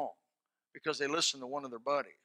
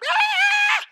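put-on piercing high shriek of a kid; imitated kid scream in a restaurant; mock child scream; 12 dB louder than usual. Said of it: "They'll be running around".

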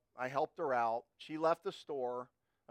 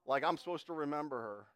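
ordinary talk, nothing marked

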